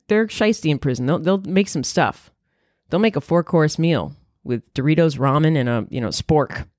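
ordinary talk

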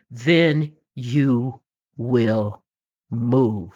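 very slow, low and drawn out; slow emphasis. Real, not acted. Telling listeners when they will finally move, slow and low. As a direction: lean in and stretch it out.

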